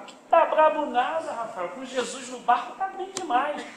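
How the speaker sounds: high-pitched, cheerful brush-off; imitating a faithful sick woman. Dying sister answering the pastor from her bed, imitated high and undaunted. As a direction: play the undaunted church sister, pitched well up. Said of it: "Pô, irmã, tá brabo?".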